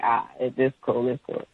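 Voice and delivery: quiet retold brush-off; backing down. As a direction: drop the voice and concede. Backing down from the argument.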